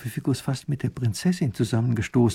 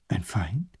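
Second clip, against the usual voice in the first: softer, lower, stunned; startled echo; 4 semitones lower, 4 dB quieter than usual. Acted. Grand duke echoing the professor, stunned and soft. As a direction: repeat it under your breath, shocked.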